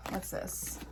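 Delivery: unvoiced curious whisper; whispered question. Real, not acted. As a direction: ask yourself softly. Peering at a card.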